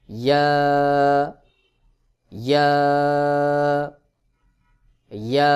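ইয়া pressed from the middle of the tongue, loud; loud, drawn-out recited ইয়া. Shown as a demonstration. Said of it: "একটু চাপ দিয়ে উচ্চারণ করতে হবে".

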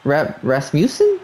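Rasmussen as "ras-MYOO-sen", slow and questioning; puzzled corrected pronunciation. Real, not acted. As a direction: sound out "Rasmussen" with a "myoo" middle, rising at the end. Questions the game's pronunciation of the name.

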